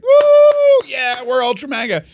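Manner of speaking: very high, loud whoop; hammed-up celebratory yell; mock crowd cheer. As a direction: whoop like a thrilled rally crowd. Crowd cheers the new label.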